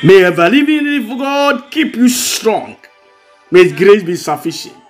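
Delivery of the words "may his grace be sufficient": normal talking voice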